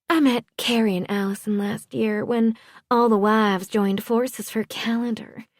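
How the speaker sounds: high-pitched Southern society wife. A narrator's reading. Wife recalling meeting the in-laws, Southern accent. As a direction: chat in a high Southern character voice.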